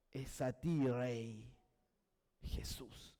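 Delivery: low, soft, falling to a near whisper; prayerful, voice dropping away; hushed reverence. Acted. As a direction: let the name sink into a breathy hush. Reverent close of spoken prayer.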